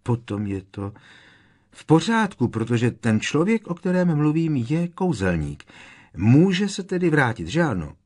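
high, loud, elated reasoning; almost overjoyed with relief; relieved. A narrator's reading. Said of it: "málem se úlevou rozesmál".

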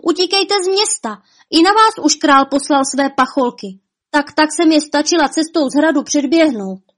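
high, urgent warning; breaking bad news in a high, pressing voice; alarmed cry. A narrator's reading. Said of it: "vykřikla".

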